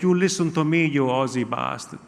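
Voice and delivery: angry Italian uncle voice, kept low-key; Gino. Acted. Uncle telling off the bottle shop man, indignant Italian accent. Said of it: "I got a very angry".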